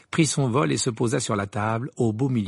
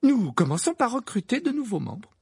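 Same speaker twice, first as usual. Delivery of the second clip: high, happy announcement; cheerful Hermione; 11 semitones higher than usual. A narrator's reading. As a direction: announce the plan gladly. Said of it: "d'un ton joyeux". (d'un ton joyeux)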